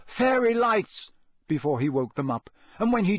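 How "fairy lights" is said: high, drawn-out yell. A narrator's reading. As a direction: yell the password to wake sleepers. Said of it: "Harry had to yell".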